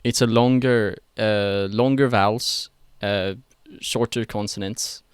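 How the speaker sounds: Swedish accent, slow stretched vowels. Shown as a demonstration. Explaining longer vowels to friends, slow Swedish accent. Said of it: "Kinda like that".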